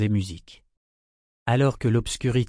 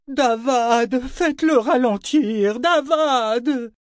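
high, drawn-out yell; 13 semitones higher, 4 dB louder, much slower than usual. A narrator's reading. Ronica begs Davad to slow the coach, yelling. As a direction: scream to the driver, stretching the words. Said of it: "tandis que Ronica hurlait".

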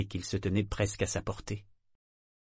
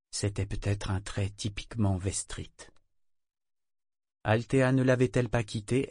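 usual speaking voice

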